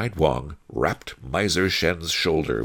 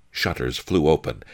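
ordinary delivery

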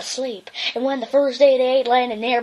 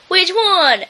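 timid dog; 5 semitones higher, 4 dB louder than usual. Acted. Drover voice.

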